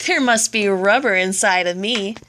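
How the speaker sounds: ordinary delivery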